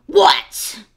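loud, impatient bark; growled. A narrator's reading. Fox snapping at the stalling piglet. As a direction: snap at the piglet. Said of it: "growled the fox".

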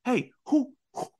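high, loud, frantic repeated questioning; mock-frantic hero demanding answers; frantic Luffy. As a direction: demand it over and over, rising in panic. Luffy voiced, frantic retelling.

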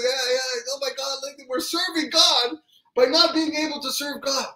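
very high, elated exclaiming; jubilant celebration. Acted. Dancing brothers waving the pail around, jubilant rejoicing. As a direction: celebrate as if hoisting a trophy. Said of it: "he started to dance".

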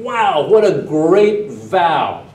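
hammed-up awe at a great vow; mock gushing praise. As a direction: gush like you are hugely impressed. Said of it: "All the different sermons said".

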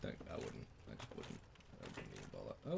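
soft low whisper; hushed unvoiced aside; whispered advice. Real, not acted. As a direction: whisper it as a warning.

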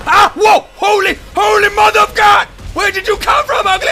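high, loud shocked yell; startled scream. Real, not acted. Gamer screaming at a monster that appears. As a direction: scream from a sudden scare. Jump scare by demon.

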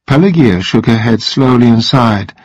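ordinary delivery

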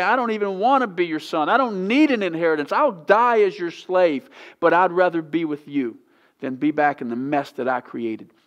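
the prodigal son begging his father; desperate plea. Retold or imitated. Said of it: "the guy did in the pig pen and say".

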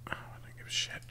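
unvoiced, under the breath; whispered dismissal. Real, not acted. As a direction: whisper it like you don't care.

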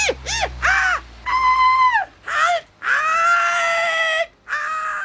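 rising panicked yelps, halt!; panicky cry. Acted. Goblin yelps as his toy car rolls off. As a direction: squeal in alarm as the car gets away.

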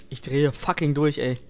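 ordinary delivery

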